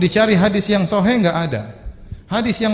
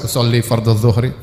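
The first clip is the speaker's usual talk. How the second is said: fully voiced, audible recitation; recited out loud; 5 semitones lower than usual. Shown as a demonstration. Preacher showing what everyone would overhear, recited aloud. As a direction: say it aloud in a lower pitch, for all to hear. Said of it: "orang semua dengar".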